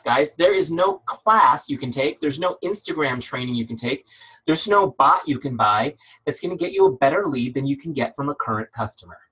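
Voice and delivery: ordinary talk, nothing marked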